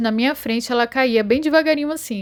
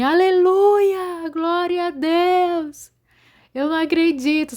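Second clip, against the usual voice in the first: much higher, slow, drawn-out cries of praise; retold joyful shouting; 8 semitones higher, slower than usual. Dreamer recalling her joy as the sky opens, slow high shouts of praise. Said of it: "E eu começava a gritar assim".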